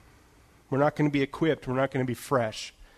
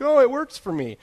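voicing coworker; 10 semitones higher, 5 dB louder than usual. Coworker's reply voiced.

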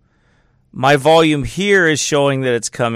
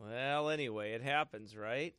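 really quiet; 15 dB quieter than usual. Real, not acted. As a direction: speak barely above a murmur. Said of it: "Do I sound really quiet?".

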